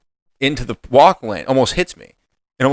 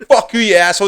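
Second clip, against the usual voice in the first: high, louder cursing shout; retold angry yell; 9 semitones higher, 4 dB louder than usual. Cursing out the driver in the walkway, heated yell. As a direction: spit the curse at him.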